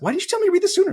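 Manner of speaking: mock outrage. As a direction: protest at the wife in high dismay. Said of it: "I was like".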